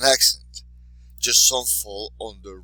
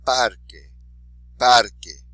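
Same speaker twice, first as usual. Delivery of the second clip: "Parque" with a loud PAR; stress on next-to-last syllable; 2 semitones higher, 11 dB louder, slower than usual. Shown as a demonstration. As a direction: model "parque" twice, punching PAR. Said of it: "stress the underlined syllable".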